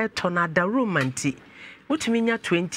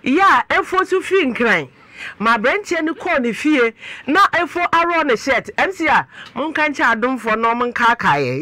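raised, higher-pitched scolding host; loud exclaiming; 3 semitones higher, 5 dB louder than usual. Real, not acted. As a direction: tell the whole room, loudly and emphatically. Host loudly recounts guest's affair.

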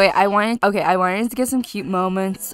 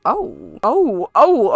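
ordinary delivery

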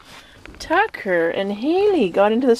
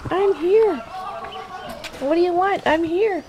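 voicing the puppy; 5 semitones higher than usual. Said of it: "You're like".

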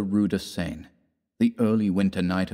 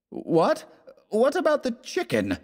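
much higher, distressed groaning; high anguished groan. Acted. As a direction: groan it in disbelief. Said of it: "groaned Fallowby".

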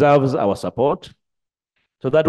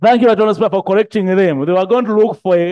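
raised voice over laughter; loud over laughter; 4 semitones higher, 4 dB louder, much faster than usual. Real, not acted. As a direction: call it out over the room's laughter. Thanks speaker amid laughter.

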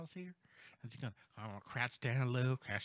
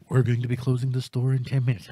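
loud, announcer-like store PA call; store announcement; 3 semitones lower, 14 dB louder than usual. Acted. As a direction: play the closing announcement over the speakers. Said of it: "I'm going to do a dramatic reenactment".

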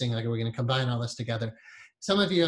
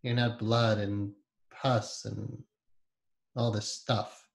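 ordinary talk, nothing marked

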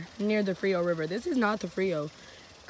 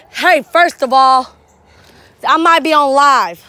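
calling out; 5 semitones higher, 14 dB louder, slower than usual. Real, not acted. Shouting to friends outdoors.

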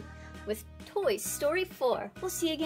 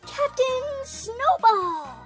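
high, loud, drawn-out hero's call; heroic proclamation; 10 semitones higher, 6 dB louder, slower than usual. Acted. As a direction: proclaim the superhero's name, stretched out. Heroic title called out.

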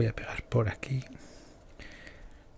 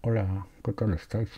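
talking through fake teeth; fake buck teeth; 6 dB quieter than usual. Real, not acted. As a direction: mouth full of false teeth. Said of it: "No me puedo reír porque".

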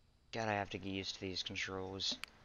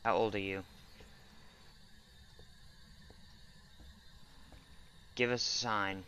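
talking to a ghost. Real, not acted. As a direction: shout questions at the ghost. Calling out to ghost.

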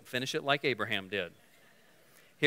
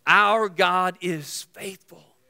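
slow emphatic declaration; 3 semitones higher, 6 dB louder, much slower than usual. Acted.

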